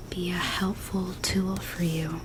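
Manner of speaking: ordinary delivery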